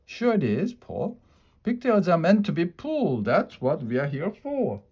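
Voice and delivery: high, sly coaxing voice; pigtail voice. A narrator's reading. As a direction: talk him into it sweetly. Pigtail persuading Paul.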